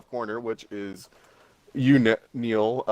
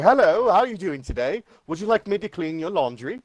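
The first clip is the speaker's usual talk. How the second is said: higher-pitched put-on British accent; 6 semitones higher than usual. Retelling a college prank to friends, put-on British accent. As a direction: ask politely in a mock British accent. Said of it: "I was doing a British accent".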